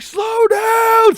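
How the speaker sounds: full-volume shriek, pitched very high; frantic, top-of-the-voice shout; panicked scream. Retold or imitated. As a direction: scream at the driver to stop speeding. Screams at ninety-five.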